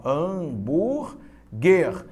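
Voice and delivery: "Hambúrguer" very slowly as ham, búr, guer; syllable by syllable. Shown as a demonstration. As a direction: stretch "Hambúrguer" into three separate syllables. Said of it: "separa as sílabas comigo de hambúrguer".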